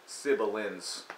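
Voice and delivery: "Sibilance." said slowly in three syllables; mispronounced siblings. Shown as a demonstration. Showing how [name] says siblings, slow three syllables. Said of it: "that's how you say siblings if you're [name]".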